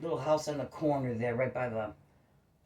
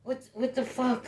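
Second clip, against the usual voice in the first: outraged; 10 semitones higher than usual. Real, not acted. Outraged exclamation.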